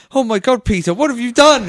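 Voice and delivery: hammed-up old aunt discovering something awful; shocked Aunt May. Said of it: "this goes".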